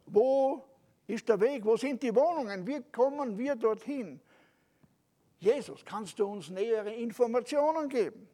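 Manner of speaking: eager questioning. Acted. Thomas pressing Jesus with questions.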